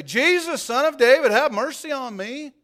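high, loud beggar's plea; crying out. A narrator's reading. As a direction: cry out to Jesus from the roadside, high and loud. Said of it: "he began to cry out and say".

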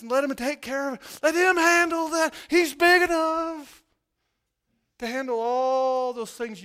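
loud, high exhortation, pressing; preaching at full force. Acted. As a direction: urge the congregation to let go.

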